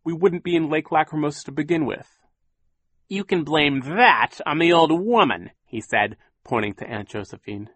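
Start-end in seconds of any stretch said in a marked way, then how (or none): 0.0-2.2 fierce, louder and higher. A narrator's reading. Violet fiercely answering Captain Sham, louder. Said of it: "Violet said fiercely". 3.1-5.5 high, mocking villain voice rising on orphans; sneering villain. A narrator's reading. Captain Sham taunting the orphans, high and mocking.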